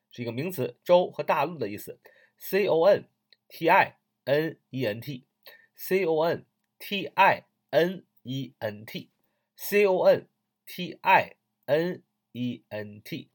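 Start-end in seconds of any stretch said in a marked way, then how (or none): 2.4-13.3 deliberate letter-by-letter dictation for learners; slow spelling. Shown as a demonstration. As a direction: spell it out slowly, letters in small groups. Teacher spells the word aloud. (一个字母一个字母慢慢拼读)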